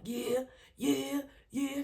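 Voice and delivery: high, loud celebratory yells; gassing up. Retold or imitated. Coach gassing up a kid, hyped. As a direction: cheer the kid on hard. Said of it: "I gas them up a lot".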